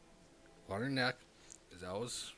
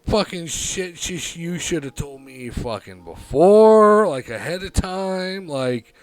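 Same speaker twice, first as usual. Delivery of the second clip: higher, louder exasperated rant; angry rant; 6 semitones higher, 11 dB louder than usual. Retold or imitated. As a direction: rant at her, fed up. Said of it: "I'm just like".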